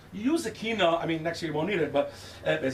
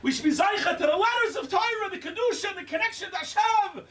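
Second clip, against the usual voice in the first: stronger redo; 13 semitones higher than usual. Shown as a demonstration. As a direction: push it harder the second time. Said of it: "One more time".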